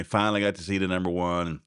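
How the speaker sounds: ordinary delivery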